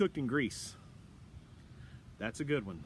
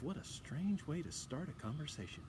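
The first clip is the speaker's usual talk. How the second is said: inner thought; 10 dB quieter than usual. Retold or imitated. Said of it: "I thought to myself".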